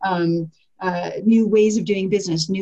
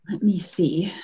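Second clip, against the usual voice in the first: whispered aside; 4 dB quieter, slower than usual. Real, not acted. Searching notes aside.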